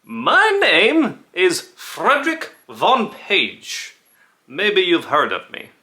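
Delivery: put-on high, loud, stretched-out accent for Frederick; put-on character accent. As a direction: ham up a high, wandering foreign accent. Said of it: "The accent was never the same".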